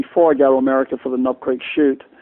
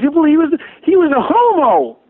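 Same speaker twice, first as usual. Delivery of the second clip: much higher, slow put-on Southern American voice; hammed-up Southern American drawl, pitched way up; American Southern accent; 13 semitones higher, 4 dB louder than usual. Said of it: "I can't do the American accent".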